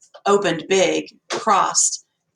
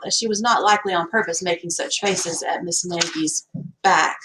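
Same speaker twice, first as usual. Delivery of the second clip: usual speaking voice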